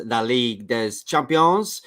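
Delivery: slow, strained French for La Ligue des Champions, des as /dɛz/ with a sounded S; a first clumsy try at French, drawn out; bad French accent. Shown as a demonstration. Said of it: "I can't do the accent like you".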